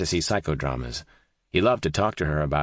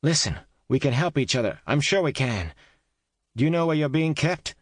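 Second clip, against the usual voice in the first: calling out; 9 semitones higher than usual. A narrator's reading. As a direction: call out to persuade. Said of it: "called out again".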